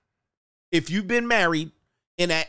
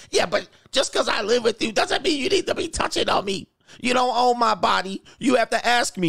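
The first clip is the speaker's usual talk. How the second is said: higher, put-on voice of an offended wife; mock indignant wife; 7 semitones higher than usual. Coach voicing a wife telling her husband off, mock indignant. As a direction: play the wife scolding her husband, pitched up.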